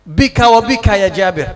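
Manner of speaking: loud scolding of her husband; angry scolding. Retold or imitated. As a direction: scold Jabir loudly. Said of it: "sempat ngomel". (istri memarahi Jabir dengan keras)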